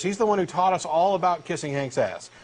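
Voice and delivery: ordinary talk, nothing marked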